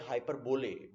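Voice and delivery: हाइपरबोले with "BO-lay" at the end; spelling pronunciation. Shown as a demonstration. Teacher citing the common misreading, हाइपरबोले as HY-per-BO-lay. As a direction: say हाइपरबोले like hyper plus bole. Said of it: "this is not hyperbole".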